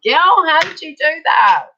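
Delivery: high, loud, slow, marvelling; amazed girlfriend. Retold or imitated. Mother of one asking friend with many kids, high amazed gush. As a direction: gush it like you can't believe it. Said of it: "and I'm like".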